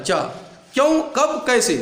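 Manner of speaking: normal talking voice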